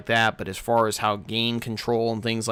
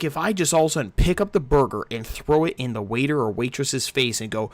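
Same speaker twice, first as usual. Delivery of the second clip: ordinary delivery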